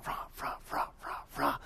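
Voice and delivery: very quiet, half-hearted cheerleader chant; listless cheer. Retold or imitated. Preacher mimicking cheerleaders doing their job, listless and very quiet. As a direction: mumble the cheer with barely any voice. Deadpan imitation of bored cheering.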